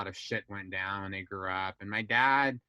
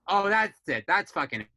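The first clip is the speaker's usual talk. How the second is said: loud, raised-pitch indignation; fed-up outburst; 6 semitones higher, 6 dB louder than usual. Retold or imitated. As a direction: re-enact hitting your limit. Retelling own outburst at the news.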